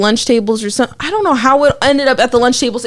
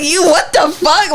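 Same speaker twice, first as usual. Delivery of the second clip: higher, slowed stunned reaction; caught off guard; 4 semitones higher, slower than usual. Retold or imitated. Recalling her reply to the accusing friend, taken aback. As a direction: act out baffled recoil, pitched up. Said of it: "Caught off guard".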